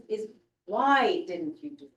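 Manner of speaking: usual speaking voice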